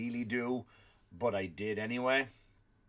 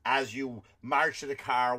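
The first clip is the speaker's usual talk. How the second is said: usual speaking voice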